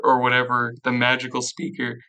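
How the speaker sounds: ordinary delivery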